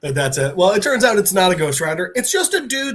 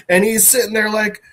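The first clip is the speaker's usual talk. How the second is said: ordinary talk, nothing marked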